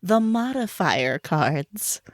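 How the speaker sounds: slow, drawn-out reveal; dramatic announcer. Pretend. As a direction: draw out the announcement slowly. Host announces twist dramatically.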